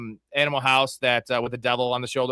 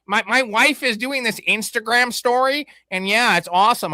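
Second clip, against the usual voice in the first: higher, slowed puppet voice mocking a boring interview; Kermit voice; 7 semitones higher than usual. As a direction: parody a dull quarterback as a puppet. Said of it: "talk to Kermit for fucking two hours".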